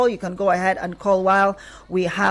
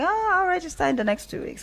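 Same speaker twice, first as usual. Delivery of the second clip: a high, offhand "ah, later" voice; procrastinating listener; 10 semitones higher, faster than usual. Retold or imitated. Host imitating a listener who will register late, high and offhand. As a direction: toss it off like someone who will put it off.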